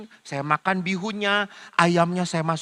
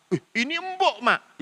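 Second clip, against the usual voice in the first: put-on high childish delight; imitating a child's delight; pleased child; 7 semitones higher than usual. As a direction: sound happily surprised like a kid. Child delighted by soft chicken.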